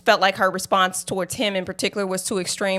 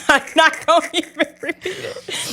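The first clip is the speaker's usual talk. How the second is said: laughing; 9 semitones higher than usual. Real, not acted. Laughing off a word she fumbles.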